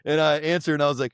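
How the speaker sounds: ordinary delivery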